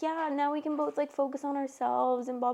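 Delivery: mock his cheerful ease; imitating a cheerful boyfriend; chipper. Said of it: "he was, like, chipper".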